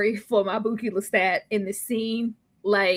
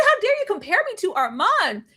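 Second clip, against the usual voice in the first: mock outrage; 9 semitones higher than usual. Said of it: "He said".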